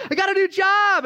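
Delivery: high, loud, celebratory cry; mock elation. Preacher acting someone who just got hired, hammed-up elation. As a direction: whoop it out high like great news. Acts out joy at new job.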